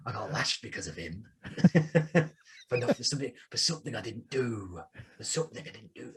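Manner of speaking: put-on Yorkshire accent. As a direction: mutter it like a wronged soldier. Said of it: "Yorkshire accent".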